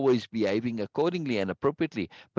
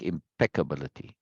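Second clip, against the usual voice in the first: impeccability with PEC picked out; im-PEC-cability; second-syllable stress; 6 semitones lower, 3 dB quieter than usual. Shown as a demonstration. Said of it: "Second syllable".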